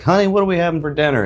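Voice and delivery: put-on high, quick Southern country accent, as if home again. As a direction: slip into a rural Southern accent on the phone. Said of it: "You sound like a banjo right now".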